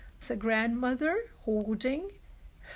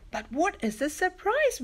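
mild storybook shout, raised in pitch; mock shout; 7 semitones higher than usual. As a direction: call it out higher without real volume. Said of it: "we shouted".

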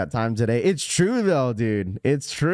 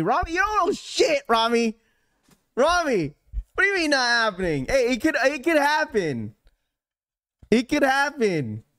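excited protest; 9 semitones higher than usual. Real, not acted. Mock outrage at a chat comment.

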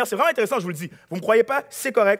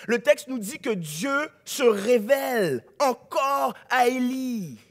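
ordinary delivery

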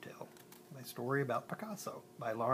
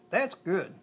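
usual speaking voice

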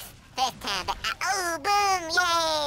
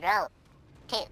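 normal talking voice

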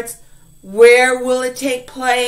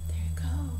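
softly whispered; 11 dB quieter than usual. Real, not acted. Teacher whispering an aside to the class, whispered. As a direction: breathe it out unvoiced. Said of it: "whispered aside".

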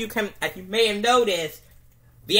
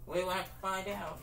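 much softer, far-off repeat; distant echo; 15 dB quieter, faster than usual. Pretend. Announcer's tagline echoing away, much softer. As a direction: let it fade like an echo.

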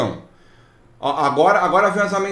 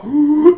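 mock gasp; 10 semitones higher, 9 dB louder than usual. Mocking the client's indignation.

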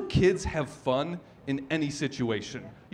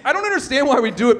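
ordinary talk

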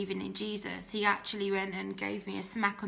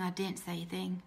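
softer, sinking repeat; a softer echo of her own silence; quieter repeat; 3 semitones lower, 6 dB quieter than usual. Real, not acted. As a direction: let it drop away on the repeat. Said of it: "and I didn't say a thing".

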